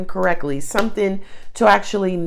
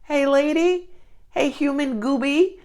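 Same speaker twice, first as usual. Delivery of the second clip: higher, whiny lion in pain begging for help; lightly higher pained animal voice; hurting lion; 6 semitones higher than usual. Retold or imitated. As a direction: play a wounded king of the jungle pleading.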